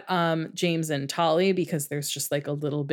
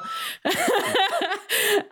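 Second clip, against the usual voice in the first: high rising giggle; high laugh. Real, not acted. Laughs at own bad accent.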